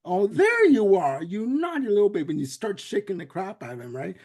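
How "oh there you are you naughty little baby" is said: higher and louder, scolding-sweet mother; doting mother voice. Retold or imitated. Bugs plays mother to baby Finster, higher and louder doting voice. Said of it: "he goes into the routine like".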